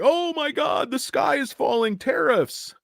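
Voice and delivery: squeaky high mock alarm; mock the panic sellers, way up high. Investor mocking panicked shareholders in a mock panic. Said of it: "It's like".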